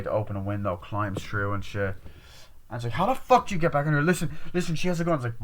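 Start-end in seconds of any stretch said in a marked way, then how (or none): 2.9-5.2 high, fast, louder retelling of panic; agitated accusation. Retold or imitated. Girl warning the others of a gun, agitated and high. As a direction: fire out an acted warning, pitched up. Acted quarrel over the gun.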